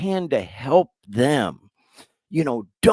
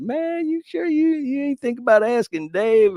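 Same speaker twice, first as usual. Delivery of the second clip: raised, lightly voiced teasing friend; a playful higher voice as the sponsor; imitating his sponsor; 12 semitones higher than usual. Retelling how his friend teased him, in a mock higher voice. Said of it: "and she said".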